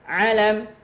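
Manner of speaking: high, loud model word; drill call. Shown as a demonstration. Teacher modelling a word for students, loud drill call. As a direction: call it out for a class to repeat.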